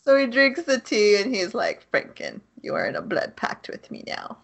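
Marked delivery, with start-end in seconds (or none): 1.9-4.4 lower, hushed and ominous vampire line. Pretend. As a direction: speak it like a looming vampire. Vampire voice in retold scene.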